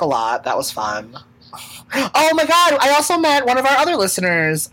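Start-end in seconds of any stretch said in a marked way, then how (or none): 1.9-4.7 high, loud burst of excitement; suddenly remembering, higher and louder; excited. Real, not acted. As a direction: blurt it out excitedly.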